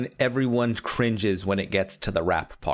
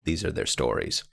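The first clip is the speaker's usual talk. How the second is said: TV intro narration; 3 semitones lower, faster than usual. Pretend. Law and Order intro recited.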